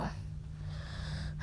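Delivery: long, soft weary sigh; quiet resigned exhale; exasperated sigh. Acted. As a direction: sigh out your reluctance.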